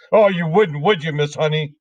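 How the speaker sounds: a nasty headmistress mocking small children; Trunchbull voice. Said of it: "the Trunchbull said".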